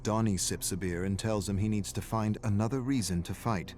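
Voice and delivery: ordinary delivery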